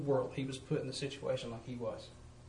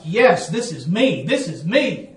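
retold crying joy; 9 dB louder, slower than usual. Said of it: "I am crying".

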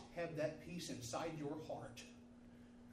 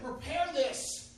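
urgent order; 10 semitones higher, 8 dB louder, much slower than usual. A narrator's reading.

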